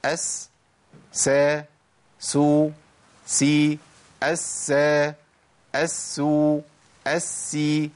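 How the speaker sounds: أس سا سو سي each crisp; all sin cases in a row. Shown as a demonstration. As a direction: run through the sin forms one by one. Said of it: "هجيب الحالات ورا بعضها".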